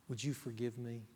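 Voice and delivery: humble apology. Retold or imitated. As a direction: ask it gently, humbled.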